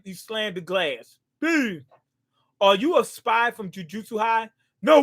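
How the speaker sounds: ordinary talk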